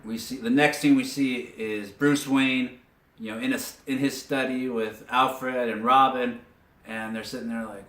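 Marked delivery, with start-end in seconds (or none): none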